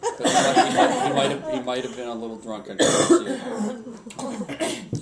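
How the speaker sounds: high laughing admission, slow at the end; laughing, high and loud. Real, not acted. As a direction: laugh while confessing he drank.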